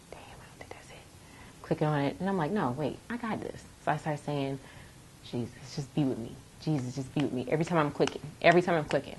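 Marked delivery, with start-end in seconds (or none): 0.1-1.0 very soft, voiceless whisper; hushed muttering under the breath; whispered self-doubt. Retold or imitated. Retelling doubts during the test. 2.5-7.5 quiet pleading to get through; hushed self-talk. Retold or imitated. Retelling self-talk and prayer during test.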